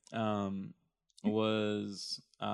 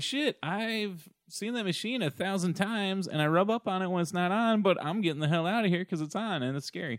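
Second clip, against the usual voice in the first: higher-pitched voice for a spooked cat; 6 semitones higher than usual. Acted. Host speaking for the cat scared of the vacuum, higher cat voice. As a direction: talk as an indignant cat backing away. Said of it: "the cat's all like".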